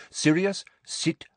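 curt, forceful command; sharp order. A narrator's reading. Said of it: "said Lupin sharply".

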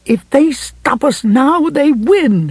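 slow, high-pitched ferocity; ferocious. A narrator's reading. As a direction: drive it home fiercely, pitched high and drawn out. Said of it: "Ferocity was the last thing".

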